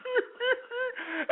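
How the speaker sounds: high whimpering fake sob; a hammed-up wail between words; mock sob. Mocking the crying victim, fake high sob. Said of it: "she was sitting there crying".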